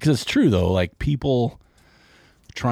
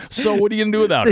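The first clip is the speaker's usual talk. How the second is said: laughing; 14 semitones higher, 6 dB louder, much faster than usual. Real, not acted. Laughing joke to guest.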